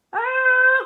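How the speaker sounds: loud, high startled cry; surprised cry. A narrator's reading. Captain finding the empty biscuit barrel, loud cry. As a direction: cry out in dismay. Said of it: "cried the captain".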